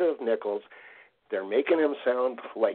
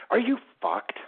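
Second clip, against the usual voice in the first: indignant jab; 12 semitones higher than usual. Real, not acted. Cohost ranting at Bomber fans.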